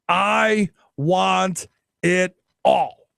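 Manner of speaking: very slow, word by word; deliberate demand. Acted. Negotiator opening with a maximal demand, slow and deliberate. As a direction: spell out the demand one word at a time.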